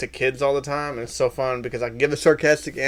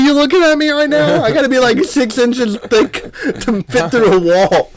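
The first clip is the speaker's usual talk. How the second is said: high, loud disbelief, drawing out the words; incredulous outburst; 11 semitones higher, 7 dB louder than usual. Retold or imitated. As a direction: protest loudly, pitched way up. Incredulous comeback to the lady's warning.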